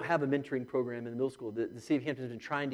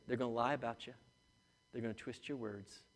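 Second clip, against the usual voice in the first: ordinary delivery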